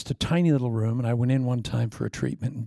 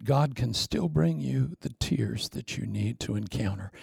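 ordinary talk

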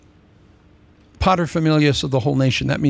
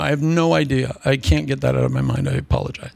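usual speaking voice

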